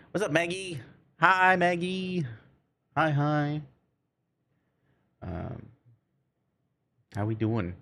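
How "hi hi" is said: normal talking voice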